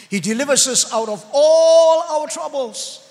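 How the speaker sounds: loud, emphatic preacher's climax; full-force preaching. Real, not acted. Preacher assures congregation of God's deliverance, in loud, rousing preaching. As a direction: drive the promise home, stretching key words.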